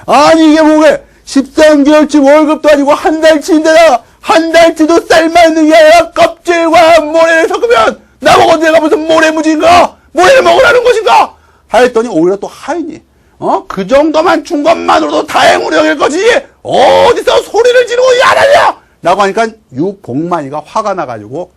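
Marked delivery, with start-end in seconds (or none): 0.1-11.4 high, loud outraged rant; angry shouting. Acted. Soldier finding sand in his rice pay, angry shouting. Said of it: "화가 나고 소리를 질렀죠". 13.7-18.8 high shouted scolding, building at the end; angry retort. Acted. Servant shouting down the complaining soldier, angry retort. Servant yells back in acted quarrel.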